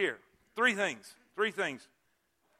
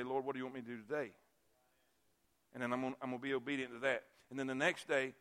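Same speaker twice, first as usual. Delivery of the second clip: low, quiet and quick, almost to himself; hushed old missionary; 8 semitones lower, 9 dB quieter, faster than usual. Retold or imitated. Old missionary quietly explaining his daily prayer, hushed. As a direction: let the words fall soft and humble.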